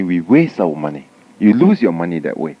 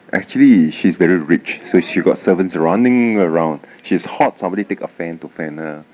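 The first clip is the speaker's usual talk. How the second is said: ordinary delivery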